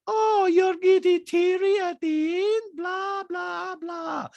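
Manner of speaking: high, slow, sneering send-up; hammed-up mocking Bosnian voice; [name]. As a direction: taunt loudly way up high, then ease off. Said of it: "like a real Bosnian asshole".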